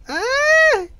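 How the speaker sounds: high startled cry; sudden high yelp. Acted. Pencil snaps.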